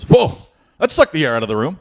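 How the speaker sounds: loud high whoa, then brisk quip; breezy auctioneer back on the mic; emcee's reaction. Retold or imitated. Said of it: "the M C gets back on".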